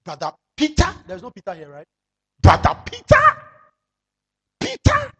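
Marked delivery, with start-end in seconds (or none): 2.4-3.2 loud high call across the room. Real, not acted. Preacher calling a congregant's name loudly. 4.6-5.1 loud high call across the room. Real, not acted. Preacher calling a congregant's name loudly.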